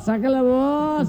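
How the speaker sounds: put-on high, slow drunken wail. Drunk mocking the men who won't dance, slow wail. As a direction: stretch it out like an indignant drunk. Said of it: "Y un borracho dice".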